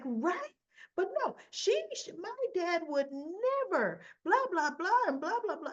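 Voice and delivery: high, whiny mocking imitation of a daughter; mock Christina. Recapping Christina defending her dad to Molly, high mocking whine.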